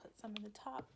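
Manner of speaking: whispered aside. Real, not acted. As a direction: hush it to a whisper. Whispered aside while working.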